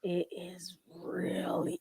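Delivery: slow, whispered emphasis; hushed dismay. Real, not acted. Reacting to a bad speech, whispered dismay. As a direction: whisper it slowly, drawing the words out.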